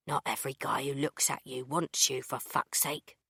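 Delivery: a touch of a man's low annoyed reply; lightly voiced Tim. Tim snapping at narrator.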